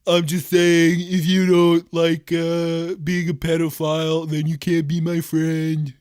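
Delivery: slow, drawn-out caricature voice; Donald Trump. Acted. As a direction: drag out each phrase as a mock president. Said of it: "That was a pretty good impression".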